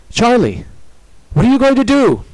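high, loud, urgent question; alarmed. A narrator's reading. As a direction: cry out in alarm. Alarmed partner as she jerks away.

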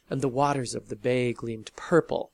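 ordinary delivery

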